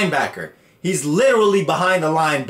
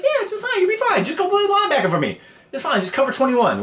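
much higher, hammed-up reassuring coach; mock soothing coach; 12 semitones higher, faster than usual. Coach calming Petey before sending him in, high mock coo. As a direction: coo at him like he's a scared kid, fast.

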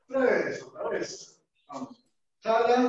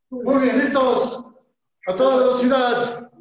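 shouted drill commands; 6 dB louder than usual. Real, not acted. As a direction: bark the start like a trainer. Instructor starting a timed drill.

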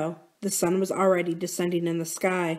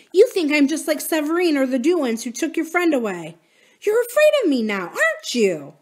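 higher-pitched, heated accusation; accusing; 8 semitones higher, 4 dB louder than usual. Acted. Corinne accuses Drew of fearing her, heated. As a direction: press the friend to admit it.

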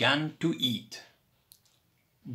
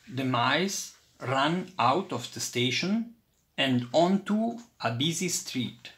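ordinary delivery

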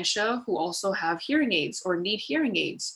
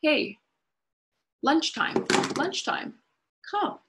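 higher, short bright calls with pauses; simple cue call; 8 semitones higher, slower than usual. Shown as a demonstration. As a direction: call out each cue word on its own. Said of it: "you can say".

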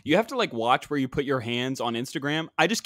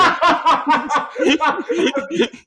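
loud high cackle; helpless loud laughing fit; high burst of laughter; 11 semitones higher, 7 dB louder than usual. Real, not acted. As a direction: crack up hard and high. Host cracking up at photo.

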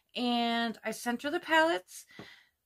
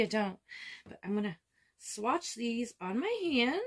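ordinary talk

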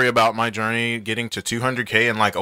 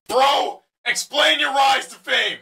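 impatient heckler shout; 13 semitones higher than usual. Acted. Heckler interrupting the creator, high and shouting. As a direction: bark at him to get to the point.

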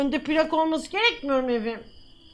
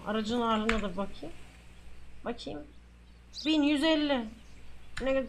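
usual speaking voice

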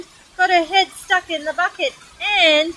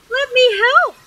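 high, loud offer of help; bursting to help; eager cry; 10 semitones higher, 5 dB louder than usual. Acted. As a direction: pipe up eagerly, pitched right up. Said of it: "cried Little Miss Helpful".

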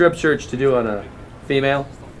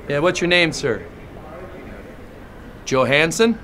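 usual speaking voice

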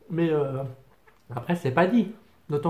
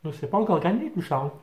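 chewing while talking; speaking between bites; mouth full. Real, not acted. Seen 0: Bites food at -2; mouth still working food while speaking.

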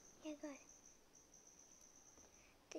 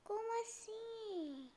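surprised; 6 semitones higher, slower than usual. Real, not acted. Reacts to dying in game.

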